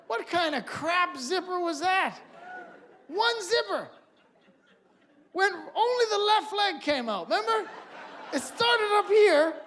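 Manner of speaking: worked-up comic tirade; excited rant. Real, not acted. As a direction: rant at that awful zipper. Outraged rant about the snowsuit.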